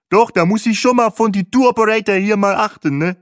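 high, loud character voice; loud, high-voiced bus guide; Bahe, chipper tour driver. A narrator's reading. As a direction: pitch it up high, loud and chummy.

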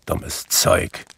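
low-key grumble under the breath; grumpy, half-voiced muttering; grumbling. A narrator's reading. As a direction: grumble it as an afterthought. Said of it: "brummte hinterher".

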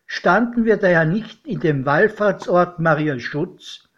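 plain reading voice

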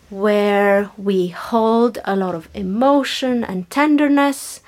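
normal talking voice

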